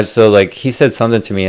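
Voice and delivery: usual speaking voice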